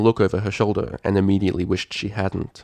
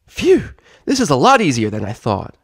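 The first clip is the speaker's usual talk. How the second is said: loud, high filly puff of effort; huffing filly; 6 semitones higher, 6 dB louder than usual. Acted. Scootaloo hauling the couch, loud and high. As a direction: brag while straining.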